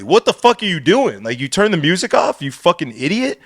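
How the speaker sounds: retold indignant outburst, starting high; mad. DJ berating the kid who turned the music off, mad. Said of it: "Like, I'm mad".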